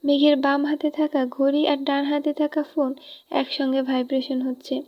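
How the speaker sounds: usual speaking voice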